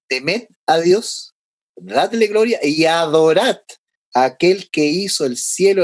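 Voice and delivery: slow, weighty, each command stretched out; slow solemn scripture quote. Shown as a demonstration. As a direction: proclaim it slowly, like a command from heaven. Quoting the first angel's command.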